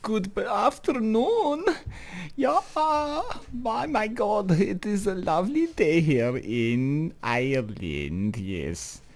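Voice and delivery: high, hammed-up fake German accent greeting; bad German accent. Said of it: "the worst German accent of all fucking time".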